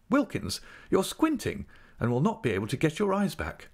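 a hint of a grand, fussing wife; Mrs Micawber voice. A narrator's reading.

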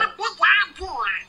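a high pealing laugh; high giggle fit; high, far laugh. Real, not acted. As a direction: cackle at the scene. Laughs at game scene.

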